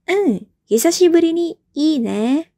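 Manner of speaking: teacher voicing B agreeing; pleased reply. Shown as a demonstration. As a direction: hum then agree happily. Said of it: "dijawab oleh si B".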